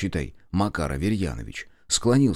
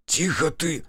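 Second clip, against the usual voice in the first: high-pitched, alarmed shush; frightened shushing; 9 semitones higher, slower than usual. A narrator's reading. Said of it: "испуганно шикнул". (испуганно шикая)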